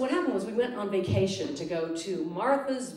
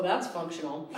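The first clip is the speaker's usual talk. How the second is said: ordinary talk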